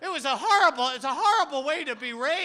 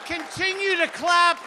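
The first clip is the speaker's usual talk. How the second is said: higher and louder, gushing at the crowd; hammed-up delight; 5 semitones higher, 5 dB louder, slower than usual. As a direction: bask in the applause theatrically. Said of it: "basking in applause".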